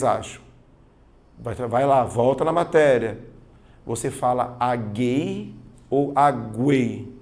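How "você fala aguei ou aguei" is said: aguei slow, first with the u silent then with the u sounded; teacher quizzing the class on aguei; two versions of aguei. Shown as a demonstration. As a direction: contrast aguei with and without the u. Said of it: "a letra U aparecendo".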